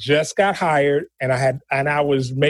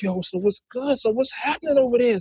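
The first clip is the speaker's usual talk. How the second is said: street corner voice; 7 semitones higher than usual. Retold or imitated. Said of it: "on the block".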